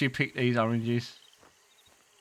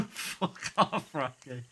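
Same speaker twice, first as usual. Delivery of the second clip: laughing; 2 semitones lower, 7 dB quieter, slower than usual. Real, not acted. Player laughing at game dialogue.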